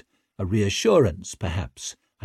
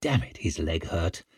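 plain reading voice

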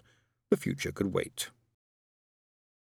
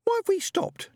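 put-on very high child's voice; high-pitched childish insistence; demanding little girl; 4 dB louder than usual. A narrator's reading. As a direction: demand it squeakily, like a six-year-old. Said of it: "demanded the suspect".

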